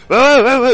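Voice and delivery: pained cries. Acted. Smoke hits his character.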